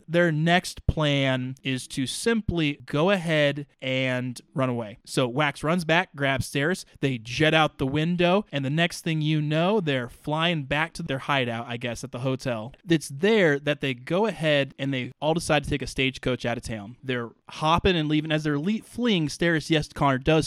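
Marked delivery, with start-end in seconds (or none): none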